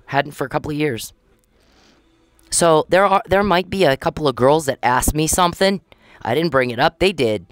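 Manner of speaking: normal talking voice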